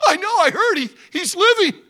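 high, loud eager father; excited old father. Acted. Father interrupting Judah, high and excited. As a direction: cut the son off with joy.

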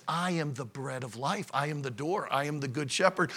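low, soft voice of Jesus; hushed quote. Retold or imitated. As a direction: drop low and quiet for solemn scripture.

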